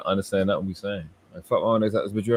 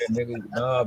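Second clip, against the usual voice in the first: ordinary talk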